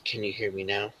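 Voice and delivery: very soft, hushed check from out of the room; whispering. Acted. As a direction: drop right down to a near-whisper. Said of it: "and he's whispering".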